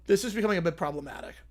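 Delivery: usual speaking voice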